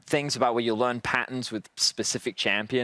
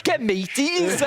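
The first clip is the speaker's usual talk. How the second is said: pirate voice; 12 semitones higher than usual. Pretend. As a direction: ham up a pirate captain. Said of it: "pirate cast".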